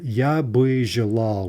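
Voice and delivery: "Я бы желал" slowly, with a full vowel in "бы"; long form. Shown as a demonstration. Teacher showing the long form "Я бы желал". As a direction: say "бы" as a whole syllable. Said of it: "would be kind of the long form of that".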